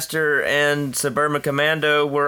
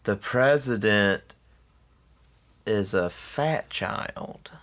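dramatic reveal; 5 semitones lower, 4 dB quieter, much slower than usual. Acted. Dramatic slow reveal line.

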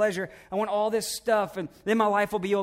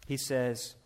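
ordinary delivery

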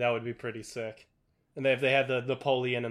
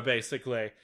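ordinary delivery

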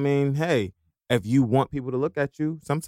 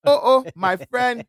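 put-on slow, high-pitched playful African accent; mock African accent; 7 semitones higher, slower than usual. Friend joking about thousand-dollar post fees, mock African accent. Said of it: "I was waiting for that accent".

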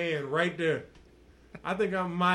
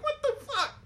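very high, squeaky with laughter; helpless laughing retelling of bafflement; laughing mock disbelief. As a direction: exclaim it while cracking up. Retold reaction while cracking up.

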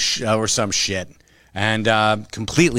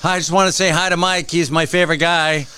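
higher, fast gushing voice; higher fast gushing fan voice; mock sweet fan; 6 semitones higher than usual. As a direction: gush like an overeager fan. Pretend fan visiting shop.

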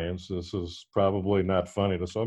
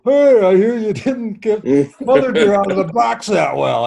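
high, loud mocking ribbing; teasing; 11 semitones higher, 8 dB louder than usual. Friends at breakfast teasing him about the ashes, loud mocking ribbing. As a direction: rib him loudly and high, like a jeering crowd.

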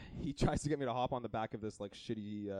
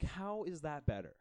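higher-pitched, incredulous questioning; exasperated disbelief; 6 semitones higher than usual. Retold or imitated. Retelling his indignant reaction to the deal.

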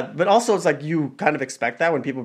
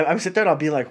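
ordinary talk, nothing marked

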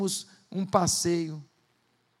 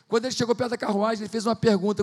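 normal talking voice

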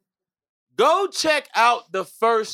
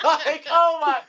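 laughing; 6 semitones higher, slower than usual. Real, not acted. Still laughing at the joke.

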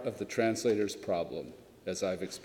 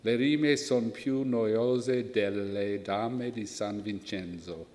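put-on Italian accent. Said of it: "pardon my Italian accent".

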